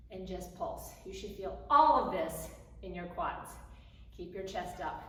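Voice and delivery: normal talking voice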